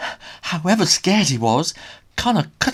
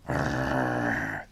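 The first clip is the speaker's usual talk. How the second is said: dog growl; 6 dB quieter than usual. A narrator's reading. Dog growl, far timbre.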